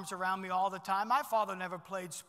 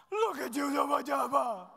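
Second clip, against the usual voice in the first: put-on high, loud baby talk to a pet dog; mock-doting dog owner, pitched high and loud; cooing at a dog; 7 semitones higher, 6 dB louder than usual. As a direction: gush over the little dog like a doting owner. Man cooing at his dog.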